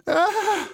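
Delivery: high sharp scream of pain. A narrator's reading. Muddle peeling dough off his hand, screaming high. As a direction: yelp as the dough pulls. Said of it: "he screamed".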